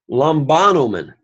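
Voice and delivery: "Lambanomen" accented on "ba", third syllable from the end; antepenult stress. Shown as a demonstration. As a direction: punch the "ba" in "Lambanomen". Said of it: "the third syllable".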